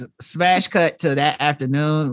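ordinary talk

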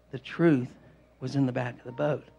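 low, softened solemn point; hushed emphasis. Real, not acted. Preacher telling congregation the truth was aboard, hushed emphasis. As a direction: let the voice drop and land it quietly.

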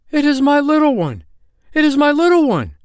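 very high, loud outcry; joyful cry. A narrator's reading. Bear wife recognises her lost cub, high joyful cry. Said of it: "the wife of Brown Bear cried".

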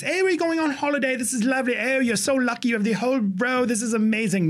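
high, gushing mock New Zealand accent; sing-song New Zealand accent veering toward Mary Poppins. New Zealand flight attendant greeting a passenger, high put-on accent. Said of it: "Now I sound like Mary fucking Poppins".

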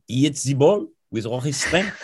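a playful put-on French accent; French accent with "the" said as "zi" and "with" as "wiz". Visitor reciting the line for the teacher, in a French accent. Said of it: "in a French accent".

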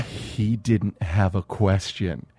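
hushed realization. Retold or imitated.

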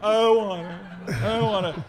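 put-on high whiny kid voice; whiny refusal. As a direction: whine it like a sulky child. Whiny reply to nagging.

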